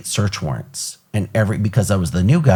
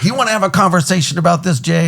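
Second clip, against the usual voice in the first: snippy challenge; 10 semitones higher, faster than usual. Retold or imitated. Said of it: "And he's like".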